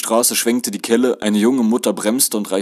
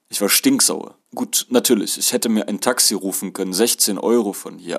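usual speaking voice

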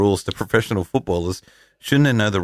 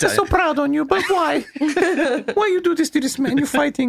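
high, hammed-up Italian nonna scolding; Italian accent. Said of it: "Can you do it in your Italian accent?".

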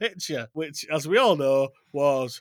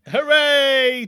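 cheer; 7 semitones higher, 5 dB louder than usual. Acted. Repeated opening cheer.

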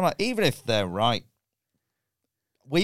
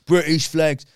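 loud, higher mock Cockney Brit abroad; mock Cockney patriot; 5 semitones higher, 9 dB louder, slower than usual. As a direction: bellow it like a Cockney tourist. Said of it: "it's Cockney?".

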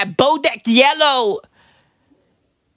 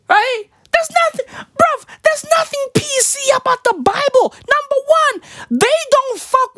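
worked-up rant; 13 semitones higher than usual. Acted. Exasperated rant, pitch high.

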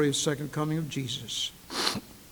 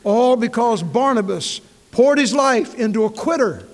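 much louder and higher, hammering it home; preaching climax; 6 semitones higher, 10 dB louder than usual. Acted. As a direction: build to a ringing shout on quitter.

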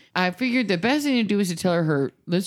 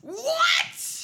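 high shocked what with a cry in it. Real, not acted. Host reacting to the mom's lie about the dead lizard, shocked cry. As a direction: cry out in disbelief.

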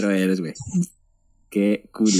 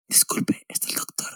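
whispered in a library; hushed whisper, no voice; 8 dB quieter than usual. Acted.

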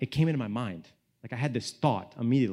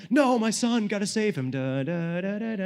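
high, loud mock alarm; overprotective dad panic; 8 semitones higher, 3 dB louder than usual. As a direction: rush to the rescue, hammed-up and high. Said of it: "too aggressive as a dad".

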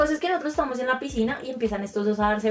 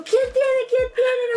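preguntas agudas y fuertes a gritos; gritando; 13 semitones higher, 3 dB louder, much slower than usual. Retold or imitated. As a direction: grita con urgencia, muy agudo. Imitando el alboroto del grupo. (gritos agudos y urgentes)

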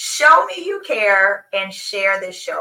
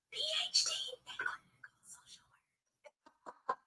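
whisper; 21 dB quieter, faster than usual. Acted. Hushed emphasis on degree.

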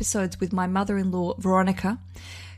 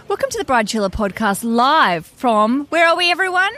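live show opener, building louder and higher; calling to crowd; 5 semitones higher, 6 dB louder than usual. Real, not acted. Podcast host asking the wedding crowd where they are, calling out. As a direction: rise to a loud shout-out to everyone.

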